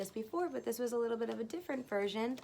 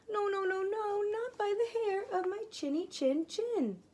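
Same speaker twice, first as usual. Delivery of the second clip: high squeaky piglet pleading; little pig voice; 11 semitones higher than usual. Acted. Teacher reads the pig refusing the wolf, high and squeaky. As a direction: squeak it out like a tiny frightened pig.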